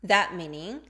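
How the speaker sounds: ordinary talk, nothing marked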